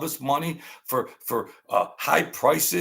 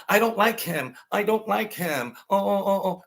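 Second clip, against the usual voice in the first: higher, mocking whine; hammed-up fake upset; mock whining; 6 semitones higher than usual. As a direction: mimic an emotional complainer. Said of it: "They're all emotional".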